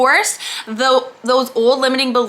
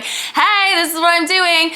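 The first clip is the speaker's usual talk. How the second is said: mock excited announcement; 5 semitones higher than usual. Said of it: "be like".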